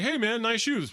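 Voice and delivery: friendly passerby. Retold or imitated. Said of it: "Guys would be like".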